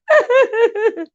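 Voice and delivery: very high, tickled laugh; high-pitched laughter. Real, not acted. Vlogger amused by own insult, high-pitched laughter.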